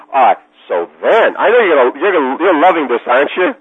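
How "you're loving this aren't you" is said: normal talking voice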